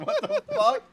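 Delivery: high laughing shriek. Real, not acted. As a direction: squeal it through laughter. Laughing squeal.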